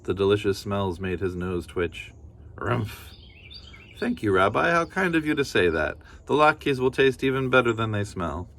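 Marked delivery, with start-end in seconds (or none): 2.6-3.1 bear grunt. Acted. 4.0-8.5 lightly voiced old woman, a bit higher; a hint of a cheerful granny; kindly grandmother. Baba Brena thanking the bear, lightly voiced granny.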